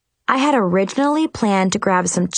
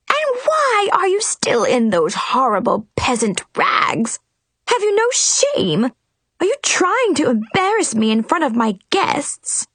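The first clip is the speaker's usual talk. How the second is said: high, shrill furious scolding; scolding Queen; 8 semitones higher than usual. A narrator's reading. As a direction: shame her like an outraged queen.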